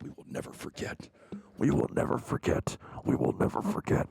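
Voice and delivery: hushed, eerie chanted warning; ominous message voice. Retold or imitated. Streamer mocks the scary banner with an eerie voice. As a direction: read it like a creepy threat.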